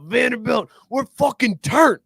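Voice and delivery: mock hyped shout of victory; imitating a hyped quarterback yelling; excited yell. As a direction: holler it like a pumped-up player. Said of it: "he yelled out".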